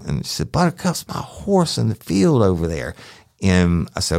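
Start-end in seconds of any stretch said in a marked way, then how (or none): 0.5-3.0 just a touch of her attitude; lightly voiced woman. Deputy quoting her answer, lightly voiced attitude.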